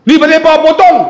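tengking orang dengan lantang dan tinggi; marah. Retold or imitated. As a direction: lakonan pegawai kaunter yang garang. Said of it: "Dia marah pakcik tu". (tengking dengan suara marah)

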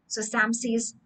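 plain reading voice

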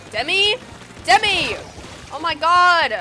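loud, high escalating shouts; exasperated calling. Real, not acted. Player shouting after Demi, exasperated. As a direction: shout the name in rising frustration.

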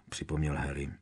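ordinary delivery, nothing marked

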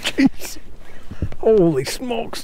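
slow and high, marveling; drawn-out amazement. Real, not acted. Angler marvels at a big fish, slow high awe. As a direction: stretch it out in awe. Another huge fish landed.

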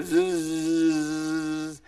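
soft bee buzz. Acted. Coyote turned bee flies off, buzzing. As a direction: buzz away like a bee in flight.

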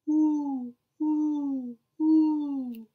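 three even, rounded hoots; imitated owl call; owl hooting. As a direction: hoot like an owl, each note held. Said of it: "He can sound like an owl".

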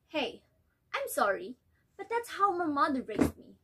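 bitter, complaining mother; resentful, defensive wife. Acted. Nella complains to husband.

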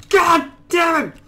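high, loud cry of rage; frustrated yell. Real, not acted. Gamer missing the jump, yelling in frustration. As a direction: let the curse burst out. Failed jump, angry outburst.

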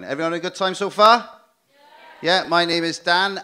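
loud upbeat stand-up energy; lively crowd address. Real, not acted. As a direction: pump up the room.

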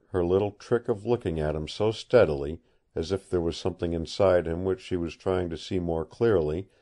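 plain reading voice

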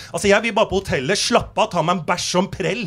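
usual speaking voice

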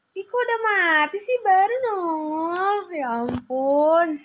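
drawn-out wail of disbelief, starting high; exasperated dismay. Real, not acted. Player who died just after appearing, in drawn-out exasperated dismay. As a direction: whine it slowly, stretching the words in disbelief.

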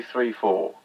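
ordinary talk, nothing marked

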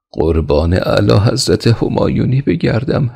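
high, frightened plea to the king; a servant's mild frightened voice; trembling servant. A narrator's reading. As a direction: beg your master, shaking with fear.

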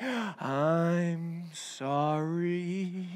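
very slow, low, soft drawn-out apology; movie apology. Acted. One guy apologizing over mood music, hammed-up sorrow. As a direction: stretch it out like a hammed-up movie moment. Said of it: "you start playing that mood music".

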